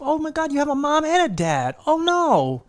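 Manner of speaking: high, loud mock dismay; a friend hamming up pity over someone's easy life; sarcastic mock pity. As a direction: gush fake sympathy. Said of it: "that's why I'm so sarcastic".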